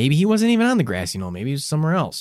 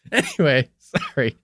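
normal talking voice

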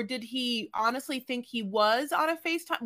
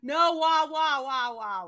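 mock wailing; 2 semitones higher than usual. Co-host protests the next couple, falling mock wail.

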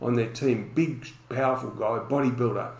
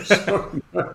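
mock yell, higher and a bit louder, laughing; retold yell; 6 semitones higher, 6 dB louder than usual. Retelling the opponent calling out sorry behind him, mock yell. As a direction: call it back across the field like the big guy. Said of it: "him yelling out".

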